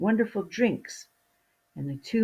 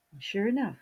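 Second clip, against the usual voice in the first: usual speaking voice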